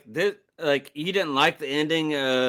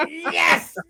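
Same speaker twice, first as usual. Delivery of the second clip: high, loud cheer of victory; mock triumphant yes; 13 semitones higher, 5 dB louder than usual. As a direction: cheer like you just won fifty bucks. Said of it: "I was like".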